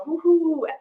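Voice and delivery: high-pitched celebratory whoop; świętujący okrzyk. Real, not acted. Celebrating two years in remission.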